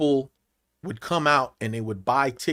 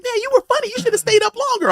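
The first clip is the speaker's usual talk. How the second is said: praising fan; 3 dB louder than usual. Retold or imitated. Fans praising him after the show.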